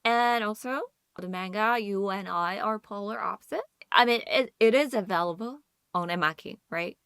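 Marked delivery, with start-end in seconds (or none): none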